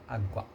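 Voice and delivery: soft "Água" split as á-guA; barely heard semivowel. Shown as a demonstration. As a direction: softly sound out "Água", the U barely there before the A. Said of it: "quase que você não ouve".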